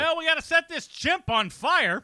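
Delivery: high, loud goofy mock voice, pitched well up; mock film crew guy. Podcast host mocking the crew planning to burn the chimp, high, loud goofy voice. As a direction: play a dopey crew member pitching a stunt.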